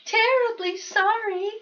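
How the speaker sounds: high, hushed little mouse apology; murmuring mouse. Acted. Mouse apologizing to Bear, high and meek. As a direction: murmur it meekly. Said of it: "murmured the mouse".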